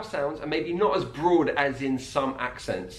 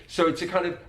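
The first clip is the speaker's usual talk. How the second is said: normal talking voice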